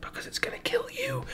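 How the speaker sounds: breathy whisper, high. Pretend. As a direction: whisper it like a scary secret. Whispered answer to game child.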